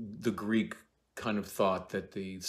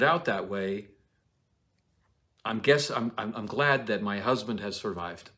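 resolving Juliet; 3 semitones higher, 5 dB louder than usual. Acted. Juliet talking herself into accepting Tybalt's death, raised voice. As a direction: reason it out as Juliet, settling. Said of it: "comes to the position of".

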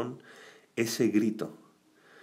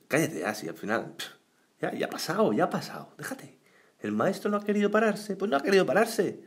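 lightly voiced dismissive bystander, higher; a hint of an impatient onlooker waving him away; discouraging crowd; 8 semitones higher than usual. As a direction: brush him off, shut him up, in a mild mock scold. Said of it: "gente que te desanima".

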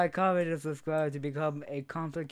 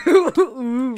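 squealing laugh; 10 semitones higher, 17 dB louder than usual. Real, not acted. High laugh.

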